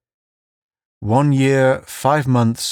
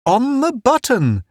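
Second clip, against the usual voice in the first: high, gleeful crow. A narrator's reading. Town checks his wrist and gloats, gleeful and high. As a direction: crow it, delighted to be right. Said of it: "he said gleefully".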